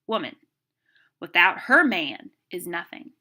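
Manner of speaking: long pause after "Woman", "her" punched loud; pause and inflection. Shown as a demonstration. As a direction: lean hard on "her man", then drop on "is nothing". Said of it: "using pauses and inflection".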